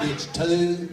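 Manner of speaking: loud, hammed-up Texan two; exaggerated Texan. Shown as a demonstration. Texan accent, pushing the audience to exaggerate. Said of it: "stick your neck out and look a whole lot more stupid".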